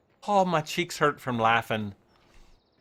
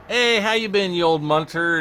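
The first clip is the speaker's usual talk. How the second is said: playful greeting; 5 semitones higher, 6 dB louder than usual. Pretend. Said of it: "I'm gonna be like".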